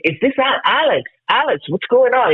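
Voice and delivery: high-pitched, urgent questioning; acting out confusion on the phone; retold alarm. Retelling his panicked phone reply.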